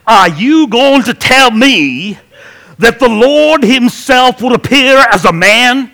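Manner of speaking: booming, high, drawn-out Southern evangelist; hammed-up TV preacher, loudly incredulous; television preacher. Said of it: "kind of television preacher guy".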